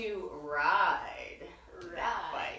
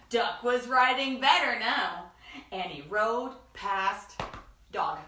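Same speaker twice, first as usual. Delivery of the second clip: usual speaking voice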